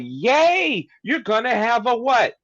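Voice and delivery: high-pitched, excited mock cheering; exaggerated, high-pitched delight; happy congratulating. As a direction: act out everyone celebrating the news. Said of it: "she's happy about it, everyone's like".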